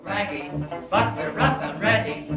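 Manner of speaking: usual speaking voice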